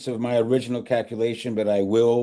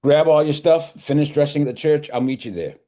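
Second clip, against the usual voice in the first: urgent; 7 semitones higher, 5 dB louder than usual. Acted. Father urgently sends son off.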